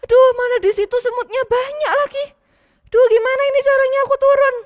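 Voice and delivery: put-on high, fretful child voice, a little rushed; boy stuck in a tree. Matthew stuck in the tree, fretting to himself, high child voice. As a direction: whine like a kid who can't get down.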